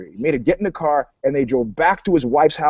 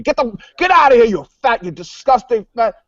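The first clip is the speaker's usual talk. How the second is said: high, louder, fast mock yell; imitating a woman yelling on the beach; retold scream; 7 semitones higher, 4 dB louder than usual. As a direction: snap it at him like an outraged woman. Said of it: "She started screaming at me".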